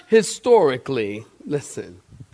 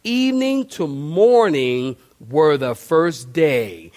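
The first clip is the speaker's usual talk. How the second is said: slow scripture recital; 3 semitones lower, slower than usual. Shown as a demonstration. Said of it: "in Genesis it tells us".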